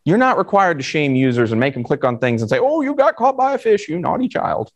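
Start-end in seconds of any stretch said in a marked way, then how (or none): 2.6-4.7 high-pitched, patronizing mock scold. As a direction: shame them like a little child, in a high put-on voice. Voices someone shaming users for clicking.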